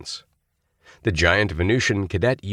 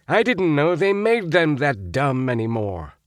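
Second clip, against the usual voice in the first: scornful; 8 semitones higher than usual. A narrator's reading. Roger scoffing to Tom.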